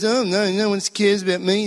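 a hint of a whimpering inner voice; self-pitying voice. The inner voice sulking in church, lightly whiny. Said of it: "that self-piteous little voice".

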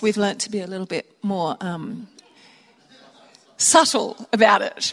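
ordinary talk

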